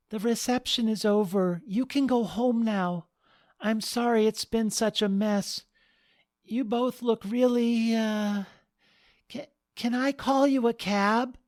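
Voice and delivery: a bride politely shooing tipsy guests. Acted. Penny politely dismisses crashers.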